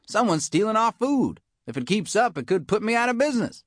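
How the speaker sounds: a tough iguana cook sounding the alarm; high anxious complaint about food thieves; worried cafeteria lady. Acted. Narrator voices Missus Bagoong.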